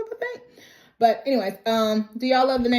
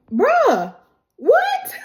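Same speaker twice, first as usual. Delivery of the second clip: loud, high-pitched mock outrage; outraged disbelief; 5 dB louder than usual. [name] confronts the newly engaged couple, loud mock disbelief. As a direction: act out a stunned, indignant reaction. [name] reacts to stolen proposal moment.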